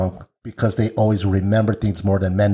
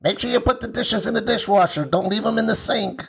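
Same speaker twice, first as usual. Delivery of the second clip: high, mock-nagging roommate voice; teasing impression of his roommate's nagging; 12 semitones higher than usual. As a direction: nag about the dishes in a put-on high voice. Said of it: "I don't sound like that".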